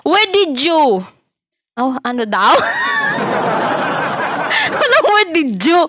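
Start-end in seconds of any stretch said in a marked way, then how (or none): 2.6-4.7 squealing laugh way up high; high cackle at the mistake; high shrieking laugh. Real, not acted. Laughing at misheard English.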